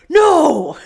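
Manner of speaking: high, very loud refusal; hammed-up furious yell; explosive mock shout. Sam refusing to drop the axe, hammed-up angry shout.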